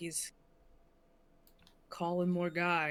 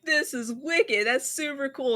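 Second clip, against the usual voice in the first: excited; 7 semitones higher, 7 dB louder than usual. Retold or imitated. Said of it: "I'm like".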